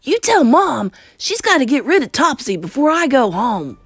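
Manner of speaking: put-on high, loud bossy child; Bruno shouting. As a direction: give orders as an indignant mouse boy. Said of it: "Bruno shouted from inside the handbag".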